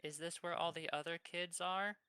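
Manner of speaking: put-on high, innocent little kid voice. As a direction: ask it like a small curious child. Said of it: "I'm gonna ask him in my kid voice".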